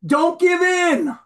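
high, loud and slow scream; the evil inclination screaming at you. Acted. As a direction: hammer out each word as a cry. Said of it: "The Yetzer Hara is screaming".

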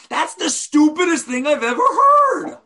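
blunt outburst. Retold or imitated. Board member voicing the unspoken opinion, exasperated outburst. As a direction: blurt out what you really think. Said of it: "But I can't say that".

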